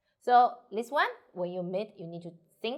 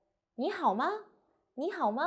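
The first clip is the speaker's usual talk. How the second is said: 你好吗 high, swinging up at the end; rising question intonation; 5 semitones higher than usual. Shown as a demonstration. Teacher showing a question sentence, 你好吗 going up. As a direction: lift 吗 upward as a question. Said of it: "Totally go up".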